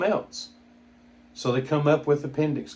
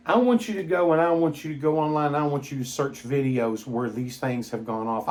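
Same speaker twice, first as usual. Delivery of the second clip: usual speaking voice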